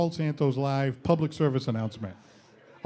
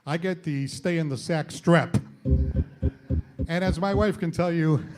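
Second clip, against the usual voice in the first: ordinary talk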